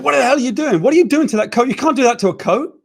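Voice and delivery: high, loud furious shouting; furious yell. Retold or imitated. Exec screaming about the coat in the pitch meeting, furious. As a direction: rage at someone mishandling a coat. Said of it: "He said".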